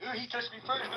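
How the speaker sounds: high, soft whining tattle; mock a crybaby pointing the finger; nasal-free hammed-up whine of a snitch; whiny tattletale. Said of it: "pull the whole law thing".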